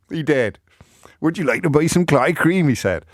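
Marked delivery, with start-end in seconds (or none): 1.2-2.7 a light West Country lilt, higher; mock Devon clotted cream seller. Host voicing the Devon cream seller for teasing listeners, light accent. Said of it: "spoke like this".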